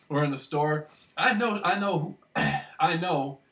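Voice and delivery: normal talking voice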